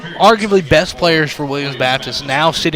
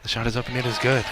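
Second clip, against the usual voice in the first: hushed golf announcer call; golf commentator; 3 semitones lower, 9 dB quieter, faster than usual. Acted. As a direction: call the shot softly, golf style. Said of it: "you gotta go to your golf announcer voice".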